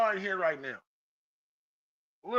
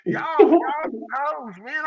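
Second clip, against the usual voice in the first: laughing; 8 semitones higher, 10 dB louder, slower than usual. Real, not acted. Laughing while calling out group.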